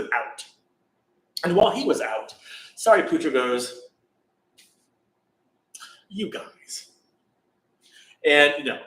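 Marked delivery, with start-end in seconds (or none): 6.1-6.9 hushed call to the monks; whisper. Retold or imitated. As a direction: whisper so the sleeper won't wake. Waking monks while Devadatta sleeps.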